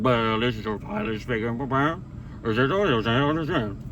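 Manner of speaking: Charlie Brown teacher. Retold or imitated. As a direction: do the garbled Peanuts teacher as the pilot. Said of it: "Like the teacher from Charlie Brown".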